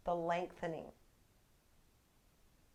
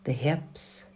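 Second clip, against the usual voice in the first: calm, measured meditation voice with long pauses; guided meditation; 14 dB louder than usual. Acted. Yoga teacher leading a resting class through a meditation, calm and measured. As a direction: lead them inward in short, unhurried, evenly pitched phrases.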